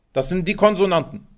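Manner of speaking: normal talking voice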